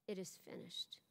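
faint whisper of dying words. A narrator's reading. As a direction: breathe it out, barely there.

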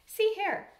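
abrupt, high and loud interjection; sudden lifted call for attention. A narrator's reading. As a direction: burst out with a new idea. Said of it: "he said suddenly".

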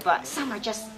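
ordinary talk, nothing marked

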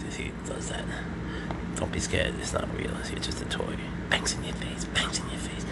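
soft unvoiced whisper to the cat. Real, not acted. Owner whispering to a scared cat about the toy, soft whisper. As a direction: hush it so the cat isn't startled.

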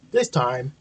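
ordinary delivery

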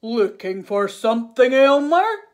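big, booming lion voice rising on the question; calling lion. A narrator's reading. Lion hails Elmer who is out searching, calling out. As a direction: call out loudly as a story lion. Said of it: "called the lion".

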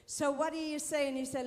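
ordinary talk, nothing marked

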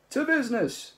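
cheerful toast. Acted. Ford and Zaphod toasting, high and loud. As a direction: clink glasses and cheer it.

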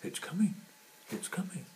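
high and hurried, then dying away; hare voice. Acted. Hare answers, fading.

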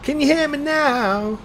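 high, slow, drawn-out silly voice. Pretend. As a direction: stretch it out in a goofy falsetto. Repeats mic check in silly voice.

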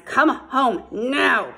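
slow, loud call through cupped hands. A narrator's reading. Todd orders his sister home across the snow, slow loud call. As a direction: stretch it out so it carries far. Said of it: "cupping his hands".

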